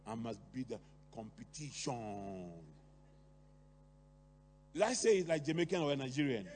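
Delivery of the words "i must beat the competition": put-on lightly done Patois accent, slow and low. Competitive parent to child, in a Patois accent. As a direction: say it in Patois, drawn out. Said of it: "they will even say it like in, in Patois".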